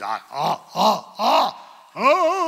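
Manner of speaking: rising caveman-style grunts; man-cave grunting. Acted. Preacher mocking men holding wrenches, exaggerated grunts.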